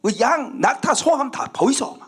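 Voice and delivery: high-pitched, whining protest to God; jealous Satan. Acted. Satan grumbling to God over Job's blessings, jealous protest. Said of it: "사탄이 질투가 나가지고".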